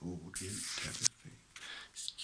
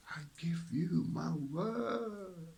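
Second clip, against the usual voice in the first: drawn out, higher, stretching every word; solemn slow promise; slow emphatic vow; 7 semitones higher, much slower than usual. Real, not acted. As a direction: savor each word slowly. Spiritual talk, repeated mantra.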